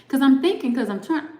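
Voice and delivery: ordinary talk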